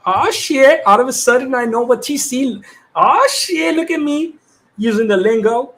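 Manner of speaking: tickled at catching on to the lingo, pitch jumping up; excited surprise. Real, not acted. Streamer amused at finally knowing what TC means, excited.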